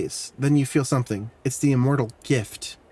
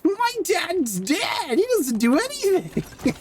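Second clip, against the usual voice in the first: much higher, slow, squeaky mock of the game kid; high mock child voice, dragged out; much slower than usual. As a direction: parrot the bragging kid in a high piping voice. Echoing game kid's boast.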